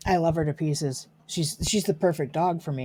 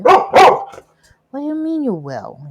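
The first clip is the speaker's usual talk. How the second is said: slow, much higher and louder mock indignation; mock outrage; 11 semitones higher, 13 dB louder, much slower than usual. Real, not acted. Owner playfully scandalised at her dog.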